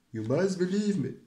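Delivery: a hint of a Donald Trump impression. Shown as a demonstration. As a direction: pump it up like a rally speech. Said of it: "how to do Donald Trump voices".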